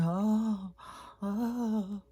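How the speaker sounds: high whimpering "oh, oh" for the sick dog; suffering whimper. Retold or imitated. Mimicking her sick dog's moans, mild whimper.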